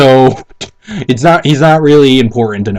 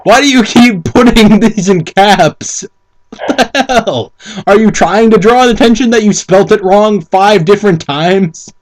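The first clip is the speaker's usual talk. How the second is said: incredulous teasing rant; exasperated mockery; 6 semitones higher than usual. Real, not acted. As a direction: scold the author in disbelief. Mocking the writer's caps.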